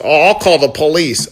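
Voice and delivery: mock scared man. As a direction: squeal it like a frightened grown man. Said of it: "Imagine being a grown man saying".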